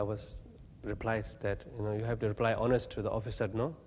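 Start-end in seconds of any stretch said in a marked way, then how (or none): none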